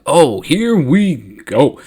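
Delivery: slow, drawn-out mock bracing; here-it-comes dread. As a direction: stretch it out, bracing for trouble. Jedi seeing an enemy coming.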